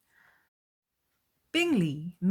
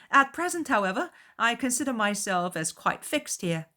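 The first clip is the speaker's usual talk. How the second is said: higher, lively and open; cheerful young host; 7 semitones higher, 5 dB louder than usual. A narrator's reading. Bingley answering the mother, higher and breezy. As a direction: toss it off lightly.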